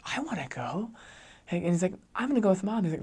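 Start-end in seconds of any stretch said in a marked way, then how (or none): 0.1-0.9 put-on high, softer pleading kid voice; imitated little boy. Little son asks to join mom, imitated pleading child. 2.1-2.8 put-on high, insistent kid voice; imitated little boy. Little son insists on going with mom, imitated child.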